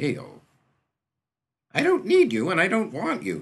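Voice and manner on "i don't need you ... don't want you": a very high, loud shout of rejection; angry refusal. A narrator's reading. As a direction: fling the words at him and leave. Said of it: "Mont said again, and stalked".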